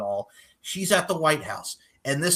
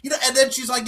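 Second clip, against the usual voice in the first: ordinary talk, nothing marked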